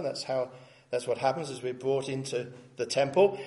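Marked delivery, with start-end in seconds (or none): none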